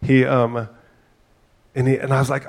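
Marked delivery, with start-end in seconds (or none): none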